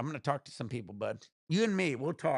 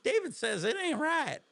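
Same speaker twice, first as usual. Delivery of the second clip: Southern accent; 12 semitones higher than usual. Shown as a demonstration. Showing chat his Southern accent, hammed up. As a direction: ham up a Georgia accent. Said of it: "I could probably do a good Southern".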